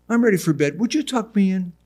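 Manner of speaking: put-on childlike mother.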